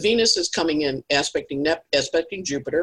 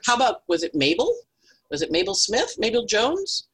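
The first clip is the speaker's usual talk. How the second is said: higher, eager questioning; prying priest; 8 semitones higher than usual. Acted. Priest in the joke pressing for a name, higher voice. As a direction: fish for the name keenly. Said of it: "little boy goes".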